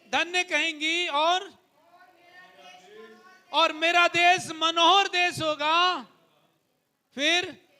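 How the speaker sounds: rising pulpit fervour; fervent preaching. Acted. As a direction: preach it to the church with conviction. Proclaiming promise.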